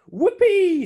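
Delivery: very high, loud whoop of joy; shouted. Acted. Monkey shouts for joy at the job, loud. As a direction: yell it out in triumph. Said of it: "shouted the monkey".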